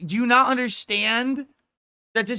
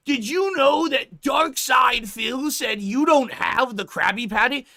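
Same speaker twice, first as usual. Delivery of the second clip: dumb caller; 5 semitones higher than usual. Retold or imitated. Mocking slow caller.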